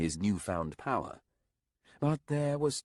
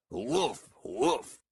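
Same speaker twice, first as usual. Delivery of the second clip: raised imitation woofs; mocking dog bark; 6 semitones higher than usual. A narrator's reading. Mock barking at the pup.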